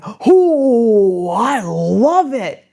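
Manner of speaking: bikers gushing. Retold or imitated. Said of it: "they all went".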